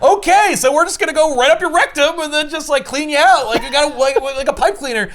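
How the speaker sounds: high, loud mock-cheerful demon voice; cheery demons. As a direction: explain it like a chirpy torturer. Demons explaining torture cheerfully.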